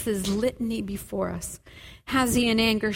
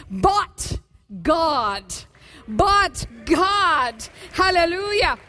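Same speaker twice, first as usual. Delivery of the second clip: preaching at full force; 4 semitones higher than usual. Real, not acted. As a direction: build and proclaim to the whole church.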